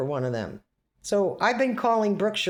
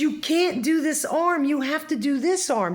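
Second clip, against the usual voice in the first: insistent; 9 semitones higher, 4 dB louder than usual. Retold or imitated. Retold insisting to nurse.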